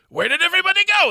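very high, loud squeaky mock French voice; helium French accent. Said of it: "doing a French accent, having inhaled helium".

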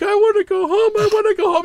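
put-on high, whiny little kid pleading. Mimicking the hostage kid begging to go home, high whine. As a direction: whimper it like a scared child. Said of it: "one kid who's like".

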